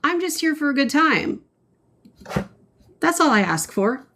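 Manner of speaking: high, fast and sweet; lightly sweet high voice; playful high voice. Acted. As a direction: tease back in a high voice. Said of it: "You're too good at that".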